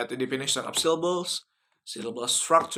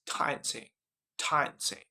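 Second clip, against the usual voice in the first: British "dancing" said "dahn-sing"; British accent, broad A in "dancing"; 4 dB quieter than usual. Shown as a demonstration. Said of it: "but in British accent".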